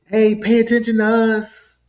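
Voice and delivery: high, loud imitated call; mocking attention-seeker; mock plea for attention. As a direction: call out like someone begging to be noticed. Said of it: "waving their hands like".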